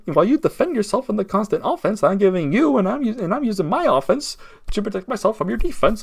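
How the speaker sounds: lightly mocking, increasingly high-pitched self-justification; mock defensive denial. Accused man watching the video denies it all, mock defensiveness. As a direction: imitate someone arguing back in circles. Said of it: "he's just shaking his head the whole time".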